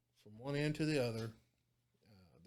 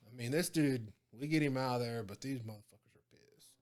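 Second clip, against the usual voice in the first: ordinary talk, nothing marked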